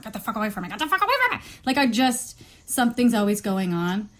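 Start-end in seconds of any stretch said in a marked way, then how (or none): 0.0-0.7 quiet irritated muttering, acted out. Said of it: "muttering or scolding or yelling". 0.7-1.4 high-pitched, held-back mock yell; half-voiced yelling, acted out; mock scolding. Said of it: "muttering or scolding or yelling".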